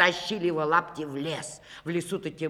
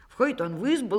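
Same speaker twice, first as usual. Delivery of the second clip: plain reading voice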